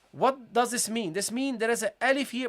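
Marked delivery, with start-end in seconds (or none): none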